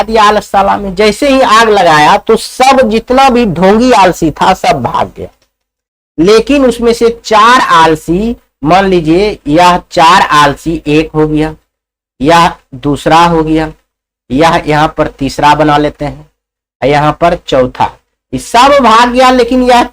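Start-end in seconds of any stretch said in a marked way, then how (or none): none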